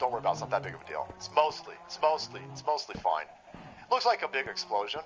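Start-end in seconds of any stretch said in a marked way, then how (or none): 0.0-3.2 soft, dismissive mock reassurance. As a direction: brush it off in a lower, quieter voice. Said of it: "Left-hand side comes out and says".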